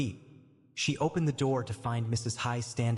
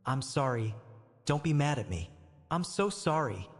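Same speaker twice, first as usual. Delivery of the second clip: ordinary delivery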